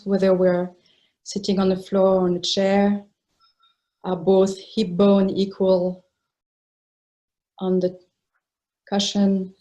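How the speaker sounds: unhurried phrases with long, settling pauses; guided meditation. Real, not acted. Teacher settling meditators into a comfortable posture, unhurried guided meditation. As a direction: guide them into stillness, leaving space between phrases.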